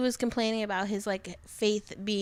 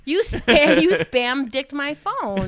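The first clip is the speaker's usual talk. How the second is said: loud, drawn-out angry outburst; heated; 4 semitones higher, 9 dB louder, slower than usual. Retold or imitated. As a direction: yell it at him slowly, furious. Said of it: "I was so heated".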